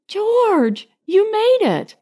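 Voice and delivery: high, delighted cry of relief; joyful. A narrator's reading. Karen greets George as he wakes, overjoyed.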